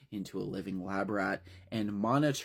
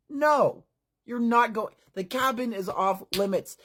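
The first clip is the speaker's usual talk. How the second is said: high, louder no, off limits; sharp refusal; 10 semitones higher, 5 dB louder than usual. Acted. Tim forbids the boys from entering the cabin, sharp high refusal. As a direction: shut them down firmly.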